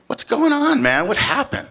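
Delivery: high-pitched, acted bafflement; bewildered questioning. Storyteller finding his friend at home, bewildered questioning. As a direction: demand answers in a high, confused voice. Asking friend what happened.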